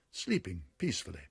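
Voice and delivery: ordinary delivery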